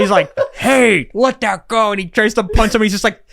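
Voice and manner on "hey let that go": high, loud mock old-man bark; hammed-up high old-man shout; old action hero. Old Harrison Ford voiced.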